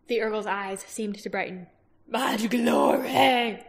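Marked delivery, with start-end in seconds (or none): 2.0-3.6 very slow, chewed Urgle words; mashed between heavy teeth. A narrator's reading. Urgle savoring the promise of glory, very slow. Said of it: "mashing the words between his heavy teeth".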